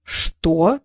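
stunned, low narrated "what"; understated shock; dumbfounded. A narrator's reading. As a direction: react as if struck dumb. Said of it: "остолбенел Трикс".

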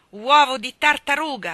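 loud scolding insult; old servant screaming at the girl; furious shout. A narrator's reading. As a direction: hurl the insult in fury. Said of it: "aveva gridato".

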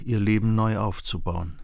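ordinary talk, nothing marked

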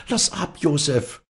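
high-pitched pleading mother; placating mother. A narrator's reading. Mother tries to calm her son, placating. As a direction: plead with him to stop. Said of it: "begütigte die Mutter".

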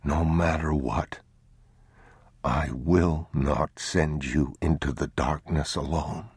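slow, soft whispered vow. Acted. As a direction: whisper a promise to a sleeping child. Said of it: "he whispered".

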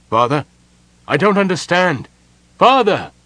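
loud, high, rising desperation; distressed cry. Acted. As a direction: call out after a vanishing father. Son calling after vanishing father.

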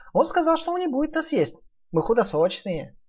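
high relieved piglet; high and glad. Acted.

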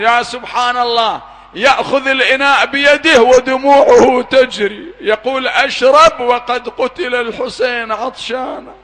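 grieving pulpit cry; impassioned preaching. Acted. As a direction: lift the voice to stir the mourners. Preacher's cry over the thirsty children.

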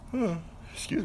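high yawn falling into words. Real, not acted. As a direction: talk through a real yawn. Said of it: "I'm yawning".

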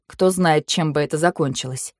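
ordinary delivery, nothing marked

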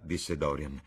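ordinary delivery

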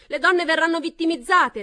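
very loud, high cry; shouting. A narrator's reading. Pamela shouting back, very loud. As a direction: shout the warning. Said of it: "replicò Pamela".

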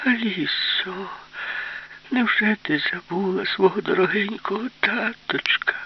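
high, tearful whine of a fake father; whiny voice. Fat Man pleading with Alisa in a whiny voice. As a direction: whimper it like a pitiful daddy. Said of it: "спитав Товстун плаксивим голосом".

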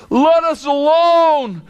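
high, pleading cry of the demon; a frightened demon crying out at Jesus; hammed-up demon crying out; cried out. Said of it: "he cried out".

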